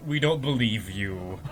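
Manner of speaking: low, slow, deadpan brush-off; dismissive council. Retold or imitated. The council dismisses Shepard's report, low and slow. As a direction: drop the voice down and flatly refuse. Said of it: "and the councils are like".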